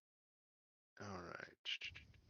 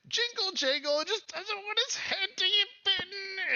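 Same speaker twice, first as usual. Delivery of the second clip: very high goblin whine; squeaky hammed-up fear; Jingle Jangle, terrified; 4 dB louder than usual. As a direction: squeal like a scared little goblin. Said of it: "to help you and being terrified".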